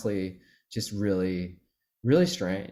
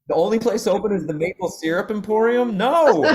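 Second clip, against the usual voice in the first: mock city-folk outrage; 10 semitones higher, 5 dB louder than usual. Big city folk in small town.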